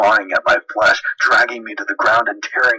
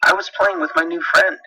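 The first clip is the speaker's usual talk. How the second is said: put-on higher, childlike and smiling; little boy voice; 10 semitones higher than usual. James tells his dad about his friend, higher child voice. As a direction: voice a small boy happily. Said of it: "he said, smiling".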